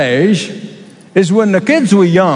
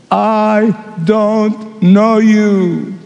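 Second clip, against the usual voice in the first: very slow, grave refusal; the bridegroom turning away the pleading ones, slow and final; stern bridegroom; much slower than usual. Acted. Bridegroom shutting the door on latecomers, stern and slow.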